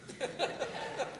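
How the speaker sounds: short unvoiced chuckle; soft breathy laugh. Real, not acted. Preacher chuckling at his own donkey joke, soft laugh.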